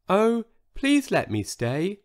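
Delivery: high, tiny pleading voice; begging. A narrator's reading. Huge dinosaur begs to stay, in a tiny voice. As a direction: plead gently in a little voice. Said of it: "begged Sizo".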